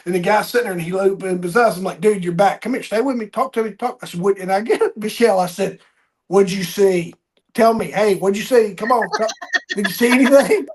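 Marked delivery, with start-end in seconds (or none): 2.0-10.7 excited urging. Retold or imitated. Urging a revived patient to talk.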